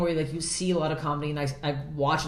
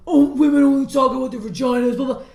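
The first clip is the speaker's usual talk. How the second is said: high, loud mock whining; mocking commenters; 9 semitones higher, 10 dB louder than usual. Comic quoting comments on her videos, mocking. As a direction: parody an online complainer, pitched right up. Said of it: "everyone always comments on my videos".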